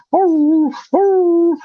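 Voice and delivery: high hooting imitation of baying dogs; mock hound bay, hoo hoo; imitating hound baying. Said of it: "they start baying".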